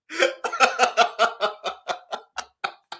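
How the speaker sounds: long, rolling laugh; real laughing fit. Real, not acted. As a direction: keep laughing, unable to stop. Laughs at the Bangers phrase.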